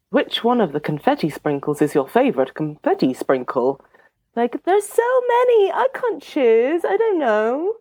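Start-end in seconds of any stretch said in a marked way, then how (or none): 4.3-7.7 mock overwhelmed. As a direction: wail helplessly over an impossible choice, hammed up. Mock despair at impossible choice.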